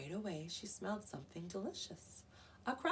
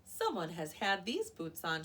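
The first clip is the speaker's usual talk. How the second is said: huffing; 5 dB louder than usual. A narrator's reading. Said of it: "she huffed".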